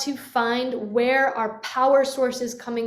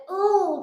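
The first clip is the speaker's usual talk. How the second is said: squeezed grunt; 9 semitones higher than usual. Shown as a demonstration. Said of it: "We don't wanna lock it".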